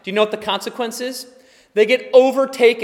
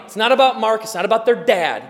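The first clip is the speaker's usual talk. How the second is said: normal talking voice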